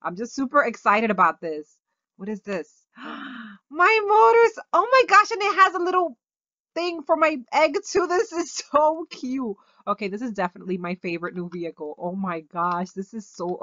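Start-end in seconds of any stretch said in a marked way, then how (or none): 3.0-8.5 delighted squeal. Real, not acted. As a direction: coo over a cute new toy. Discovers a cute new item.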